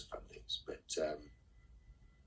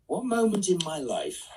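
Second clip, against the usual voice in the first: much higher, slow and louder, echoing the question in disbelief; incredulous; 14 semitones higher, 9 dB louder than usual. Real, not acted. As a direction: repeat it back as if it's an outrageous ask. Rereads fan's question incredulously.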